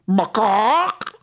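high loud squawk "bawk"; hen bawk. Acted. Nose trumpet hen sound.

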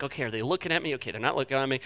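hushed, nervous self-talk; sneaky hush. Retold or imitated. Pastor sneaking a Coke at a wedding, hushed and nervous. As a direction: mutter it like sneaking a forbidden drink.

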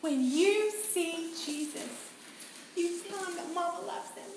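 tearful farewell to son. Retold or imitated. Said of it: "I said".

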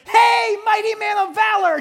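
big, high booming call; angel's shout. Acted. Angel shouting down to hiding Gideon, booming high call. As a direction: hail him from across the field.

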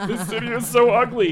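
high, loud disgusted rant; mock drunken rant. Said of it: "And I'm like".

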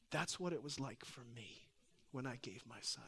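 low, very soft, solemn words; hushed voice of God. Retold or imitated. God the Father speaking to a dad going home, hushed.

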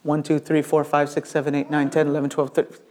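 hushed tallying of a crowd; muttered counting. Acted. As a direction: count off under your breath without stopping. Assistant counts the enemy army.

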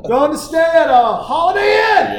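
high, loud, laughing mock outrage; dad cracking up over the hotel, high and loud; laughing shout.

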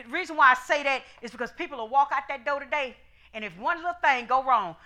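usual speaking voice

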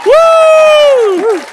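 wild high whooping cheers; rowdy party animal. Real, not acted. Cheering in healing service.

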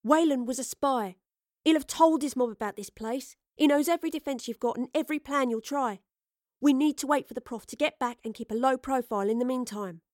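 lightly voiced cockney-ish tough woman; casual, cocky and direct; blunt street-smart woman. Astrid character voice.

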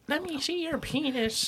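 high, slow, soft teasing voice; mock old lady. Friend pretending to be the examining old lady, high mocking voice. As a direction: put on a drawn-out, high falsetto. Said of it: "She said".

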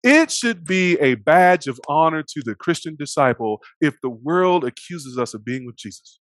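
ordinary talk, nothing marked

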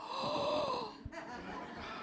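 long high, soft mock gasp; awestruck gasp. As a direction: act out a drawn-out breathy gasp. Awed as Roberts walks in.

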